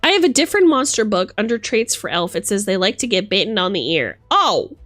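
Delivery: ordinary talk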